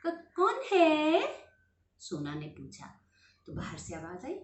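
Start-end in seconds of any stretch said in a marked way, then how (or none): 0.1-1.4 higher, louder, slow frightened question; children hearing a knock at night, frightened; scared stammer. Acted. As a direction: stutter it out in fear. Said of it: "दोनों डर गए".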